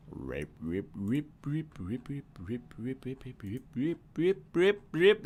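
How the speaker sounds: garbled reversed-sounding syllables; backwards speech. Shown as a demonstration. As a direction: talk like a tape played backwards. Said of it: "That's me talking backwards".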